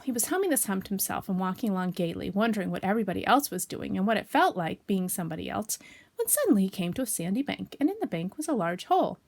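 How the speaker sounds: ordinary delivery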